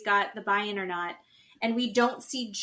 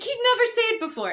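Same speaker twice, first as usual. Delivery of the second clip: high, fast, louder gush; mock excited; 13 semitones higher, 5 dB louder, much faster than usual. Woman rewatching the breakup, mock excited. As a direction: rush it out in a thrilled imitation.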